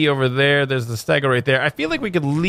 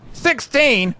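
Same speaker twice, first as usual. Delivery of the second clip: SIXTEEN called out high-pitched; excited cry; 10 semitones higher than usual. Real, not acted. Repeats number as an excited cry.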